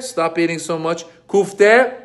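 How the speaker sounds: usual speaking voice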